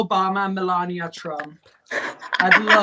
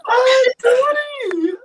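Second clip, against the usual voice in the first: laughing shriek; 6 dB louder, much slower than usual. Real, not acted. Laughing shriek at Tony's pick.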